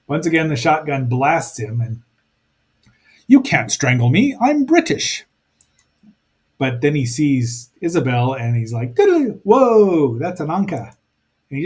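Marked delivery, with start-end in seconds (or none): 3.3-5.2 a lightly hammed indignant Briton, leaping high on "British"; mock-indignant English gent, rising sharply; mock British bravado. Voicing the inspector's defiance. 8.9-10.9 high, slow, loud "whoa"; hammed-up stunned mummy; mock surprise.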